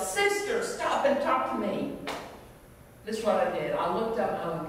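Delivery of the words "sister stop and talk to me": high-pitched call to a passer-by; yelling out. Retold or imitated. Beggar hails a woman walking past him, yelling out. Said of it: "and he yells out".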